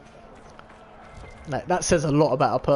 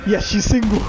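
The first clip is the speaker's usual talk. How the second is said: gleeful thought; 4 semitones higher, 5 dB louder than usual. Retold or imitated. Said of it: "I was like".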